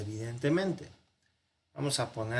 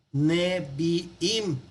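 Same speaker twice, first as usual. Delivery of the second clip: "Neviim" split as ne-vi-im; syllable by syllable; 3 semitones higher, 3 dB louder than usual. Shown as a demonstration. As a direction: say "Neviim" in three distinct beats. Profesor silabea el plural hebreo. ("Neviim" separado en ne-vi-im)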